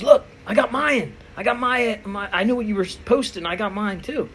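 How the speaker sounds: high, loud, excited bragging; eager gym guy. Retold or imitated. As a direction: show off his own tub eagerly. Gym guy bragging about his supplement.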